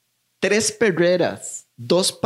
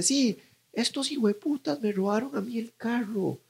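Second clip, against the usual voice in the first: put-on angry old man; 7 semitones higher, 7 dB quieter than usual. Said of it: "el roco viene y dice".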